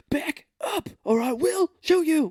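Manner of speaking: high, loud, crying mock threat; hammed-up desperate threat. Cage cornered by the town, threatening in a high crying voice. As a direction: overact a tearful desperate threat. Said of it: "and he's like".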